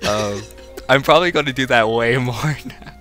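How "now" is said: ordinary talk, nothing marked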